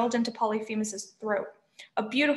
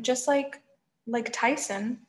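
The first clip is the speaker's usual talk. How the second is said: ordinary delivery, nothing marked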